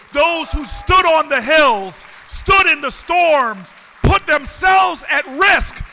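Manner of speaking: full-force political call to action; rousing oratory. Acted. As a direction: preach it to the crowd, rising with each repeat. Rousing speech close.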